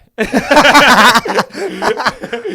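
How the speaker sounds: high, loud, helpless laugh; real loud belly laugh; big burst of laughter. Real, not acted. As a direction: burst out laughing at your own story. Bursts out laughing at own story.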